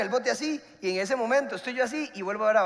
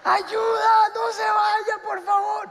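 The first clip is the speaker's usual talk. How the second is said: high, loud frantic cry for help; high desperate yell begging the boat to stay; desperate shout for help; 11 semitones higher, 7 dB louder, much slower than usual. Retold or imitated. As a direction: scream for the boat to wait. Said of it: "Pero en serio así".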